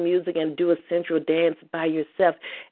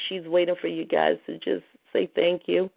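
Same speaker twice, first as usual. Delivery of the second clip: ordinary talk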